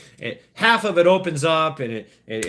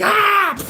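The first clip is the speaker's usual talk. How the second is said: very high, loud shriek of surprise; shrieking yelp; 7 dB louder than usual. Retold or imitated. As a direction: shriek as if you're slipping off. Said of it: "She's like".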